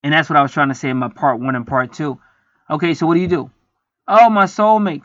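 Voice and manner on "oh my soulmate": higher, louder mock swoon over a soulmate; mocking lonely gushing. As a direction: parody a lonely person gushing. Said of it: "this is what most people do 'cause they're lonely".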